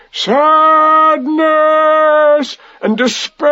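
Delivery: long wailing roar, rising high; roaring despair. A narrator's reading. Pooh lifting jar and head, roar of despair. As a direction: howl the words out slowly. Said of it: "made a loud roaring noise".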